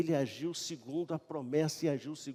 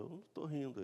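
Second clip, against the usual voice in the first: softly voiced little denial; imitating an understated, hushed protest; quiet denial; 7 dB quieter, much faster than usual. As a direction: deny it in a small, soft voice. Sara denies laughing, acted exchange.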